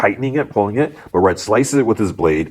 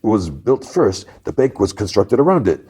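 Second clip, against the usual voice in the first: put-on lightly accented, higher-pitched guide; tour-guide lecture; 5 semitones higher than usual. Bank man gives robbers a tour lesson, higher guide voice. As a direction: recite it like a tour. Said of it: "like, a tour lesson".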